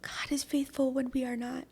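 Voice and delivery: breathy whisper of faith; hushed whisper. Real, not acted. Whispering to her friend about God's faithfulness, hushed. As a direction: whisper it like a confession. Heartfelt testimony to listener.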